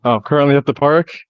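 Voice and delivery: ordinary talk, nothing marked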